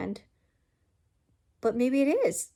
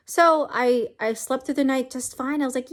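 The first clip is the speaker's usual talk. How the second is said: usual speaking voice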